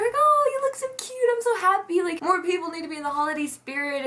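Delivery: high, cooing imitation of old ladies; gushing old ladies. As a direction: gush sweetly, starting very high and easing down. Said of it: "three old ladies come up".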